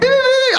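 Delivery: high, loud imitated beeping; parking sensor beeps. Comedian imitating a car parking sensor, high beeps. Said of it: "hears the beeps".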